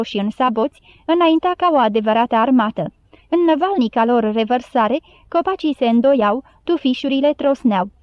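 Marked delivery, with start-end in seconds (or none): none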